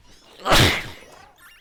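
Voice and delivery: loud sudden sneeze; a big real sneeze. Real, not acted. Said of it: "That was a sneeze".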